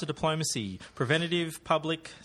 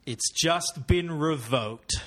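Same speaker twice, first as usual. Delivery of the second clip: very slow, weighty movie payoff; film hero's one-liner; 3 semitones higher, 4 dB louder, much slower than usual. Acted. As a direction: deliver it as a drawn-out action-film kiss-off. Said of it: "shall we hear the real answer".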